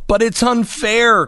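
put-on high-pitched child's protest; crying out. A narrator's reading. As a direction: cry out at the injustice. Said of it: "cried Fern".

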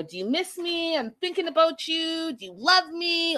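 high, pleading lovesick voice; high mock pleading; mock needy caller. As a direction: play the clingy ex on the phone. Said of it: "you know".

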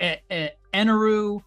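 usual speaking voice

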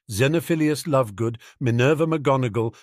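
plain reading voice

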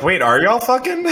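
fake-gasp at the gossip; joking disbelief; mock shock. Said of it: "I was like".

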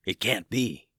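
breathless whisper of disbelief; shocked whisper. A narrator's reading. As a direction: barely voice it, stunned. Dragon shocked at ruined island.

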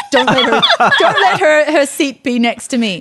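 hammed-up plea to God, laughing through it; mock desperate prayer. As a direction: pray in playful panic, pitch jumping up. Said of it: "they're praying for the first time".

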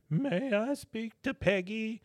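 high, slow old lady imitation. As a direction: put on a frail little old lady voice. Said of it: "you hear this old lady".

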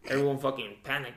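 normal talking voice

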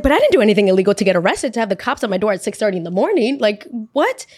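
fast, worked-up indignation; incredulous protest. Retold or imitated. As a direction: rush out a baffled self-defence. Acted protest at possible arrest.

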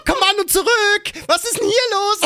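hammed-up wounded shouting; calling after someone. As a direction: yell after them, voice cracking upward. Acts calling after offended woman.

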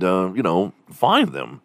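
ordinary talk, nothing marked

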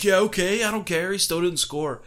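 high, loud mock brush-off; dismissive retort. Brother brushing off the fan, high and dismissive. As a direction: wave him off with scorn. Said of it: "he's like".

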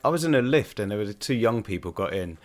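ordinary delivery

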